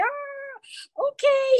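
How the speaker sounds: viel höher, ertappt überrascht; caught-out audience. Retold or imitated. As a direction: play the caught-out audience, amused. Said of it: "they're like".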